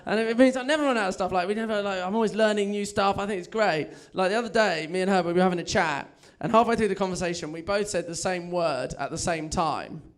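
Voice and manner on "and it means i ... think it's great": ordinary delivery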